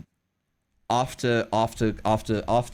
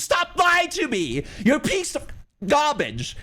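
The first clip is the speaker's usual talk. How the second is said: imitating furious teacher; 12 semitones higher than usual. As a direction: spit it out like an enraged teacher, drawn out and high. Teacher screaming insult at child.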